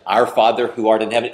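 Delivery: fast, rote recitation; quick mechanical prayer by memory; rattled-off rote prayer. Retold or imitated. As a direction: rattle it off without meaning it. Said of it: "is that what moves the heart of God?".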